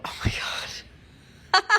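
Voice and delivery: breathless, squeaky "oh my God" breaking into laughter; amused gasp. Retold or imitated. Creator reacting to her own video, breathless amusement. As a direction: crack up at yourself, barely voiced.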